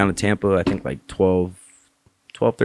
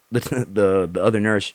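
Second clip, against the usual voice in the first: usual speaking voice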